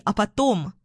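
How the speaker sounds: plain reading voice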